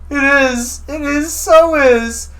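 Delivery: high, wailing mock sobs; high fake weeping; hammed-up blubbering. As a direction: whimper like a crying grown man. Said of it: "not so quietly crying".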